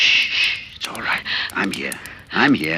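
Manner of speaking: hushed, soft comfort; calm her from the nightmare; whispered reassurance to a frightened wife; soothing whisper. Acted. Judd soothes Rory awake.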